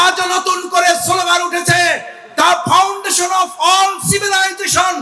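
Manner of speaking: loud, high-pitched oratory at full force; high, ringing rally speech; fiery preaching. Real, not acted. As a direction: shout it out to rally a huge crowd. Political sermon at full force.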